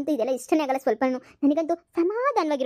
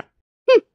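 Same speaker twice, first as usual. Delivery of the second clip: offended huff; 9 semitones higher, 9 dB louder, much slower than usual. Acted. Mother storms off offended.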